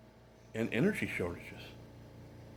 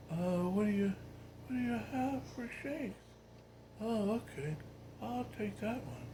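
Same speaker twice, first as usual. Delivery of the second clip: higher and softer, listless and vague; 11 semitones higher, 7 dB quieter than usual. Retold or imitated. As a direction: play a tired old man ordering a shake, barely there. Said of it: "just no energy".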